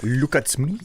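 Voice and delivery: hammed-up Parisian French accent, rolled R; proud, theatrical French accent; mock French accent. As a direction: play a pompous Frenchman with a put-on French accent. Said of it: "my beautiful, uh, French accent".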